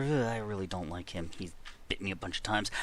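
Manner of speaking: ordinary talk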